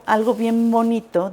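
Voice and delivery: usual speaking voice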